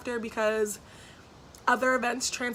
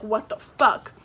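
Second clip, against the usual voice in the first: quick irritated swear, a bit louder; pissed-off curse; 4 dB louder, slower than usual. Retold or imitated. Finding trash dumped at her door, annoyed curse. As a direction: snap it out in annoyed shock. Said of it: "And I was pissed".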